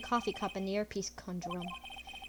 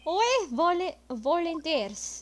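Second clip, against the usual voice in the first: put-on French accent; 2 semitones higher than usual. Streamer voicing the chef's testimony in French accent. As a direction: play the chef with a thick French accent. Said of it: "time for the accent".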